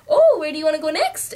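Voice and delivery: put-on high, bright, fast kid-show voice; cheery Dora. Dora asks the viewer where next, chirpy high voice. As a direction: chirp it like a cartoon host. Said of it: "she's like".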